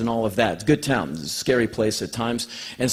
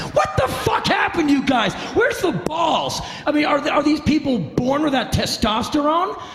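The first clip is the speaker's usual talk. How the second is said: much higher, yelling rant; ranting shout; 13 semitones higher than usual. Real, not acted. Ranting at timid rock bands, high and shouted.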